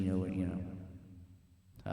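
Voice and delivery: slow, soft echoing repeat; echo fade. Shown as a demonstration. Teacher mimicking reverb bouncing between walls, slow soft echo. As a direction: let it bounce away like an echo.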